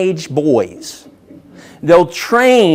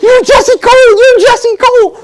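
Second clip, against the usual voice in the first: much higher and louder, a squealing fan cry; fangirl squeal; 5 dB louder than usual. Retold or imitated. Meeting his hero Jesse Cole at the bar, starstruck squealing. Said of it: "I fangirled".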